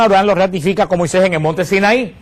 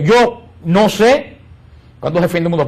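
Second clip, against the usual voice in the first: solemn Jesus. Acted. Preacher voices Jesus' words.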